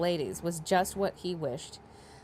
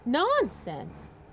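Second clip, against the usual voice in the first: higher, louder Nonsense; emphatic exclamation; 6 semitones higher, 3 dB louder than usual. A narrator's reading. Daughter dismissing the talk, higher and louder. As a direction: burst out with it. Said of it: "Miss Bennet said only".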